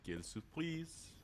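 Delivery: echoing the French phrase; dry mock French. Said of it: "What a surprise in French".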